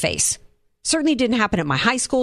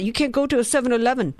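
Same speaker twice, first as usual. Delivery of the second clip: quick, mocking Indian accent imitation; imitating Biden's Indian accent, sped up; 4 semitones higher than usual. Host mocking Biden's quip, in a quick mock Indian accent. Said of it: "talking in an Indian accent".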